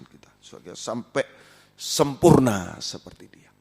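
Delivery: hushed fervent preacher; quiet preaching. Acted. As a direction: bring the congregation down gently. Soft preaching after climax.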